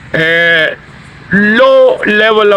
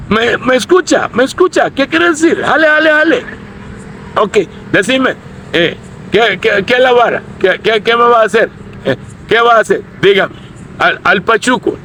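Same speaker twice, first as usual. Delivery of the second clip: higher, cocky street-slang challenge; pachuco taunt; 6 semitones higher, faster than usual. Shown as a demonstration. Street tough daring the other guy, mock taunt. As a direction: dare him to make a move. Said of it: "I just gave you a little demonstration".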